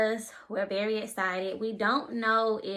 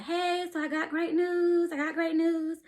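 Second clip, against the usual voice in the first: excited; 8 semitones higher than usual. Retold or imitated. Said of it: "the doctor was like".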